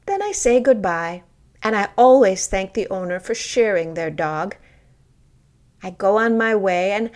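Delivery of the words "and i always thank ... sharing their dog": ordinary delivery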